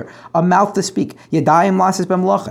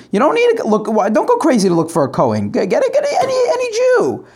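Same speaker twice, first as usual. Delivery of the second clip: exasperated outburst; 7 semitones higher than usual. Acted. Teacher acting Shmuel's exasperated advice.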